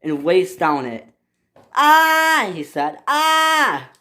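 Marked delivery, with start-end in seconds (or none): 1.8-2.5 high, loud "ah" of alarm; scream. Acted. Hugo in danger on breaking bridge. 3.1-3.9 high-pitched "ah" of alarm; scream. Acted. Hugo in danger on breaking bridge.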